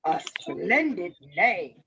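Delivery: low, slow, flattering wolf; deep, drawn-out wolf voice; sly wolf. A narrator's reading. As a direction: purr approval as a courtly wolf. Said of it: "said the wolf".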